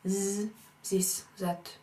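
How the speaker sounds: "this" and "that" said as zis and zat; French-style z in place of th. Shown as a demonstration. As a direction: swap the th for a z sound. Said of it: "one might substitute s".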